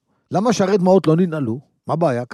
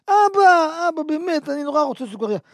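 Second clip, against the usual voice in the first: put-on pleading child; 10 semitones higher than usual. Child pleading with father for candy, much higher whiny voice. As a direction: whimper a begging plea.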